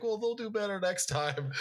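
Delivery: put-on kid's voice. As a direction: pipe up like a small kid comforting dad. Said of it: "his kids are like".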